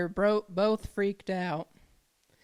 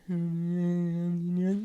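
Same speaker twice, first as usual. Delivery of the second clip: long monotone wordless mumble; imitated mumbling. Storyteller imitates brother talking to himself, wordless mumbling. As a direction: mumble along indistinctly, imitating someone in the background. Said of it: "Mumbling to himself".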